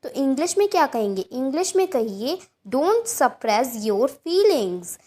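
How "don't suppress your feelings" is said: slow, careful teacher read of each word; slow model sentence. Shown as a demonstration. Teacher reading an example to Hindi learners, slow and clear. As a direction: say it slowly so students can repeat. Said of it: "teacher models example slowly".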